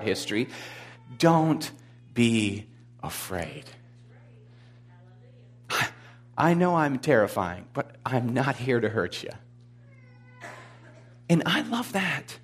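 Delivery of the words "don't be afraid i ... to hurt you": calm someone terrified, gently and unhurriedly; reassuring Jesus. Acted. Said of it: "Jesus has to say to him".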